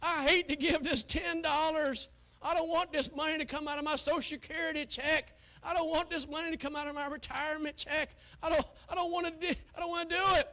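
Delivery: high, loud whiny complaint; mock grudging giver. Preacher mocking a grudging giver, loud and whiny. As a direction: whine like a stingy churchgoer. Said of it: "Not grudgingly, like".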